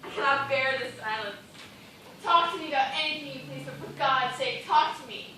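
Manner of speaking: loud, desperate plea; desperate pleading. Acted. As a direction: beg for someone to talk.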